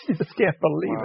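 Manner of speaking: amused, laughing disbelief; chuckling as he marvels. Real, not acted. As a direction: laugh through the words. Laughing at his own joke.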